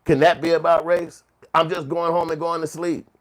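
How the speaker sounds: high, loud mock protest; imitated exasperated protest. Mimicking people tired of race talk, loudly exasperated. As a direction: push it up in mock disbelief. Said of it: "That's basically what they wanna tell us".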